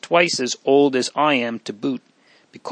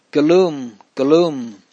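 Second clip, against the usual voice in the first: louder calling cry, falling away; 4 dB louder, slower than usual. A narrator's reading. Louis calling out again for Galoom, narrated cry. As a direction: call the name out, understated storybook cry. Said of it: "Louis cried out again".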